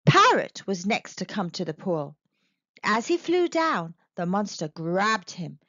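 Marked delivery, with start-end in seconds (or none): none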